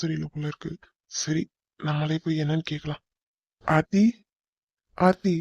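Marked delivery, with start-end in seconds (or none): 3.6-4.0 a first call, rising at the end. Acted. Calling her name from afar. 5.0-5.4 a second call, higher and louder. Acted. Calling her again, louder.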